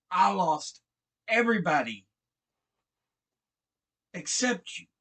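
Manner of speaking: loud, high, hurt outburst; anguished yell. Retold or imitated. Ellie yelling at Joe that he'll abandon her, anguished. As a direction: throw the accusation at him, voice cracking. Breakdown in the argument.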